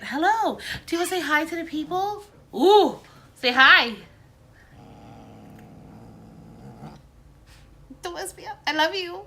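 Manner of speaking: high sing-song voice for a pet; cooing to the cat. Real, not acted. Talking to her cat.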